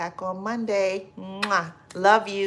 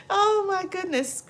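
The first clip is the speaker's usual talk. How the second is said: high, breathless with laughter; laughing exclaim; 14 semitones higher, 4 dB louder than usual. Real, not acted. Reacting to a chat joke, high and amused. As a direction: gasp it out mid-laugh.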